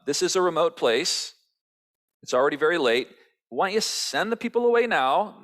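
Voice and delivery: hint hungry impatience under politeness; acted disciples nudging Jesus to wrap up; mock polite disciples.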